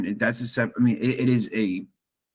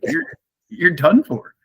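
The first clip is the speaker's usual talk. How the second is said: higher voice, amused and breaking into a laugh; 6 semitones higher than usual. Real, not acted. Podcaster apologizes to co-host for finding it silly, laughing. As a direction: talk through a real laugh. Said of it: "I'm sorry for laughing".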